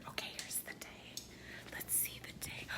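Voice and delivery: hushed whisper, fast at first. Real, not acted. Whispering to viewers while filming outside. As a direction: whisper so the neighbors won't hear. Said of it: "I don't know why I'm whispering".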